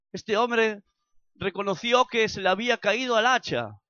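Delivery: loud, impassioned sermon delivery, lifted high; preaching at full force. Acted. Preacher urging the church to grow, loud and fervent. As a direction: drive the message home to the congregation.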